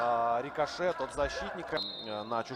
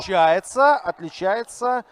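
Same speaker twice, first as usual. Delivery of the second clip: excited; 3 semitones higher, 13 dB louder, slower than usual. Real, not acted. Excited repeat after goal.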